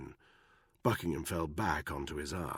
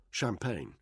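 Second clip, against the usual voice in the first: plain reading voice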